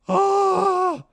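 short high shriek of frustration; frustrated scream. Real, not acted. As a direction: let out a sharp exasperated yell. Frustrated outburst at the email.

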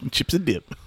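high giggly voice; laughing. Real, not acted. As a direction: laugh through the words. Laughing at the cat.

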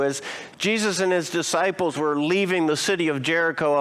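ordinary delivery

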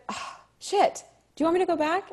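high-pitched flustered blurt; retold audition panic; flustered reaction. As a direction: gasp the curse, then ask in a high voice. Retells her panicked slip in audition.

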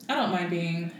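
normal talking voice

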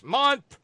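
"month" shouted, loud and high; all-caps emphasis. Retold or imitated. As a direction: punch "month" like it's written in all caps. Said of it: "per all caps".

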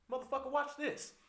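high, loud mock drunk holler; rowdy retold shout; drunk yell. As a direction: bellow it like a drunk showing off. Drunk friend quoted in story.

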